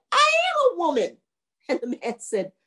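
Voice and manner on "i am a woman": very high, affronted cry; indignant ghost. Acted. Ghost corrects the young man, high indignant outburst. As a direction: declare it high and outraged.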